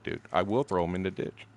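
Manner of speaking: usual speaking voice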